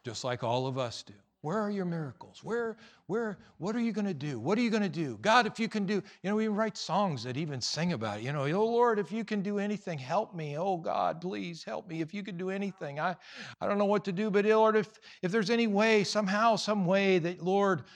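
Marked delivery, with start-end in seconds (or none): 1.4-5.9 high-pitched mock plea; hammed-up needy begging; whiny pleading. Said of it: "like, whiny". 8.4-17.8 high, pleading mock-prayer whine; hammed-up whimpering plea; whiny prayer. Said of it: "like, whiny".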